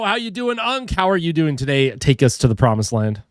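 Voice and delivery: ordinary talk, nothing marked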